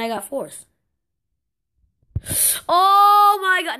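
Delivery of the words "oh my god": very high, loud, drawn-out cry; exasperated yell. Real, not acted. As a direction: explode in real frustration. Got fourth again, gives up.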